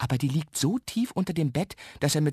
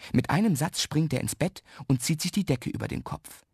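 ordinary delivery

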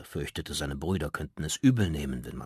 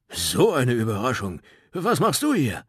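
laut und höher, überrascht drauflos; aufgeregtes Plappern; 5 semitones higher, 7 dB louder than usual. Acted. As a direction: sprudle vor Überraschung. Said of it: "bevor sie losplapperten". (die Brüder begrüßen Goliath, aufgeregt plappernd)

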